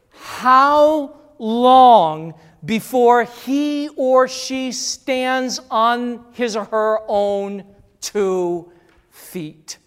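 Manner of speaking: very slow, loud stretched question; drawn-out plea. Acted. Preacher asking congregation how long, very slow. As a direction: draw each word out, pleading.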